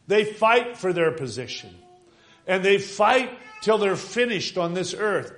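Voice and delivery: loud, high, urgent preacher's cry; preaching at full force. Acted. Preacher urging the congregation to cry out, full force. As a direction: drive it home to the church.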